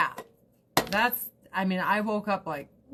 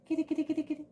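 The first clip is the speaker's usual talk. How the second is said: calling the cat; 8 semitones higher, much faster than usual. Real, not acted.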